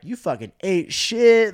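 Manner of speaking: slow, loud, mocking jeer in a put-on voice; mocking friends. Friends mocking him for bombing, slow loud jeer. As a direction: drag it out like teasing a buddy. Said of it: "and they were like".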